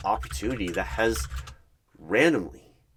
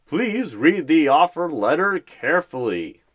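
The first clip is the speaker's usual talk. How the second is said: big, loud, higher game show host; boastful game show voice; 8 semitones higher, 10 dB louder than usual. Acted. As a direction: boom it out like a cheesy quiz host. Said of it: "big, boastful voice like he is on some weird game show".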